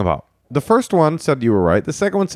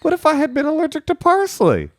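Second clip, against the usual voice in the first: much higher, laughing half-shout; laughing mock shout. Host laughing at his co-host in a high mock shout. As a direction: cackle out the silly what-if.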